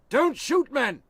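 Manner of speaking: high, loud order; loud high command; commanding shout. Acted. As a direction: stop the men firing. General stops his soldiers firing.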